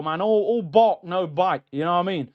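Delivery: ordinary delivery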